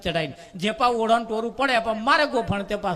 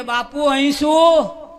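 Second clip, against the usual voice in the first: loud, slow call across the field; shouting Bapu from afar; shouted call; 3 semitones higher, 5 dB louder, slower than usual. Retold or imitated. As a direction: call out to the guru from the perch. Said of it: "બૂમ પાડી કે".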